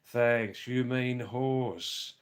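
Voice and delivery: ordinary talk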